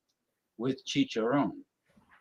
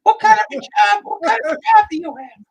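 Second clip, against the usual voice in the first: high falsetto woman's voice; squeaky high lady voice; female voice; 7 dB louder than usual. Acted. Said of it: "me do the female voice".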